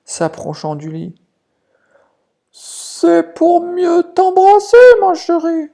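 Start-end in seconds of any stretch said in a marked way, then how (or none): 2.5-5.7 very high, slow, loud fake granny; drawn-out sugary fake grandma; wolf as grandma. (le loup déguisé, voix de mamie très aiguë et lente)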